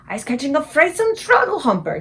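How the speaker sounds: high, loud giant cry; the BFG naming the nightmare he caught; anguished cry. Acted. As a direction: spit out the name in dismay. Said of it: "he cried".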